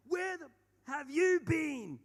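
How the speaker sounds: high, slow mock scream; screaming sister. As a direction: yell it at the kids in the back. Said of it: "The sister just turns around and just goes".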